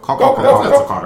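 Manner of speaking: loud high cawing; crow caw. Retold or imitated. Crow imitation.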